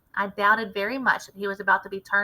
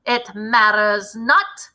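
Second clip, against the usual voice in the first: slow, louder, strange witch voice; shouting; 7 dB louder, much slower than usual. A narrator's reading. Witch shouts at the crowd, cold and slow. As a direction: decree it with cold finality. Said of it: "shouted the Grand High Witch".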